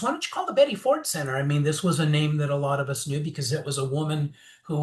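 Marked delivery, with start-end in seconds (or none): 0.0-1.4 much higher, quick motherly suggestion; lightly voiced mom, up high and fast; imitating mom. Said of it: "my mom goes".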